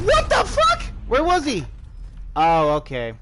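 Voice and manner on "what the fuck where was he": very high, loud what the; real startled yell at the game; startled outburst. Real, not acted. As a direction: jump out of your seat in shock. Surprised by enemy in game.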